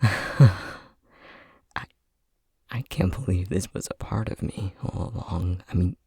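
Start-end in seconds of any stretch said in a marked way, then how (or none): 0.0-0.5 laughing. Acted. Laugh heard.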